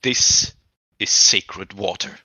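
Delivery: mother speaking to her son, grave and slow; stern mother. Acted. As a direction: lay down the law slowly. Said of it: "she says".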